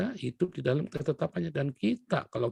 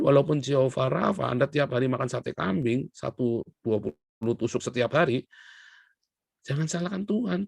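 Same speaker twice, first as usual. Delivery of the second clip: ordinary talk, nothing marked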